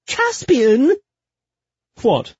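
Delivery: high, loud protest; loud, high-pitched remonstrance; exasperated cry. Acted. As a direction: call out the name in scandalised exasperation. Exasperated outburst at lover's remark.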